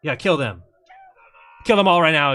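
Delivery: loud call for revenge, hammed-up; mock bloodthirsty shout. Viewer playing a furious sailor, loud mock shout. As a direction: bellow the order in fake outrage.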